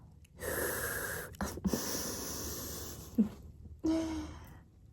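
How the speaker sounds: breathy airy giggle; whispered laugh. Acted. Laughs mid roleplay.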